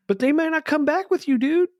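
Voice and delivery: high, eager voices; can't contain the excitement; excited. Real, not acted. Hosts excitedly debating riding the craft.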